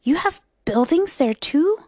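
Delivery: mildly astonished wonder; gasping surprise. A narrator's reading. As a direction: ask it amazed, voice lifting. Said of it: "Horton gasps".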